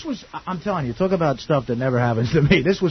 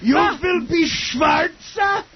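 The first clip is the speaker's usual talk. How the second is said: put-on slow, high Yiddish-accented shout; 11 semitones higher, much slower than usual. Mock character, shouting.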